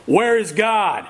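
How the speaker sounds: half-hearted bellow from an insistent pastor; mock bellow. Pastor shakes his finger in the boy's face, mock bellowing. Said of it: "and bellowed".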